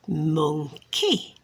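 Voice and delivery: mon, key as two separate beats; syllable by syllable. Shown as a demonstration. Counting syllables for a child, syllable by syllable. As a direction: split monkey in two with a rise on key. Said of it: "How many syllables was that?".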